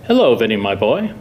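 dad's creepy, fond greeting voice. Acted. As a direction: put on an oddly creepy fatherly voice. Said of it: "My dad always talks like that".

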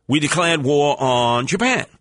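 ordinary talk, nothing marked

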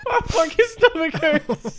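laughing pain. Real, not acted. Laughing to exhaustion.

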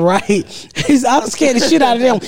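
ordinary talk, nothing marked

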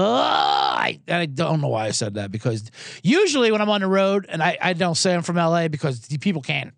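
ordinary delivery

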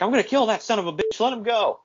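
imitating a furious wrestler; mock rage. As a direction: strain against the guys holding him. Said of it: "He's like".

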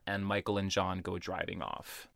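usual speaking voice

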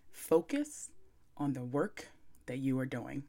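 hushed, soft and sultry; calm sexy voice. Shown as a demonstration. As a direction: bring it right down, soft and seductive. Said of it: "calm my voice down and say it again, like, real sexy".

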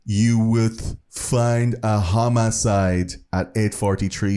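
slow, stilted imitation of a phone report; deliberate, drawn-out words as the caller; killer reporting murder. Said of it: "and he said".

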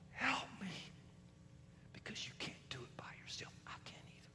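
soft unvoiced plea; whispered prayer. Believer whispering to God for help. Quiet prayer after loud climax.

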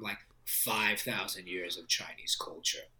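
soft whispered aside; whispering. Real, not acted. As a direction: whisper it like a secret. Whispered aside about father.

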